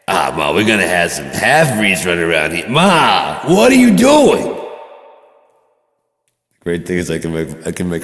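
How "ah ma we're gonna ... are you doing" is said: high, loud teasing accusation; mock outraged son. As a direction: pretend to be scandalized at mom.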